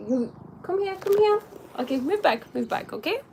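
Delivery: high, soft pet voice; high cooing come here; coaxing the cat. Real, not acted. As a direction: sweet-talk the cat over.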